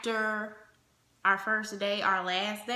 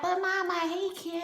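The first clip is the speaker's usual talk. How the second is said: put-on whiny young me; 8 semitones higher than usual. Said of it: "I was just like".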